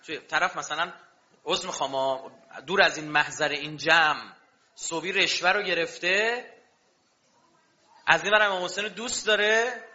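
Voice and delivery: ordinary talk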